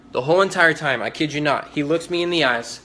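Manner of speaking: ordinary talk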